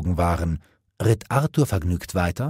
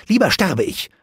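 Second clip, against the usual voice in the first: high, loud scornful princess; a defiant princess mocking her suitor; 11 semitones higher, 5 dB louder than usual. Guinevere voiced, scornful.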